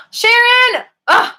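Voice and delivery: 1 delighted shriek. Real, not acted. Sees a surprise donation.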